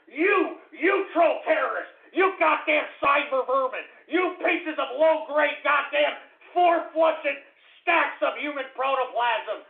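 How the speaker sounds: high, loud, spitting insults; escalating shouted abuse; furious tirade. Acted. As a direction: hurl each insult harder.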